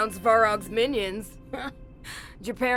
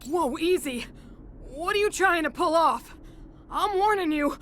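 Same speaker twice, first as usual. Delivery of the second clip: higher-pitched, tense warning; alarmed warning; 7 semitones higher than usual. Acted. Unarmed miner to armed thugs, alarmed warning. Miner threatened with loaded guns.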